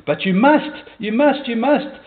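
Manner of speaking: high-pitched, insistent acted plea; imploring. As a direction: beg desperately, pressing each repeat. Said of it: "implored his companion".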